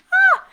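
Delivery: very high, loud laughing shriek; delighted squeal. Acted. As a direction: let out a giddy squeal. Squeal after touching belly.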